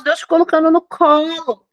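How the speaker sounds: ordinary delivery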